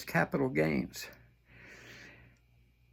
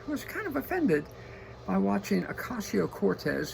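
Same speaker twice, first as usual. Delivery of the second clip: ordinary talk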